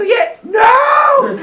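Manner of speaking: high, loud anguished wail; wailing cry. Retold or imitated. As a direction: wail as if the gold is lost. Crowd wailing as gold sinks.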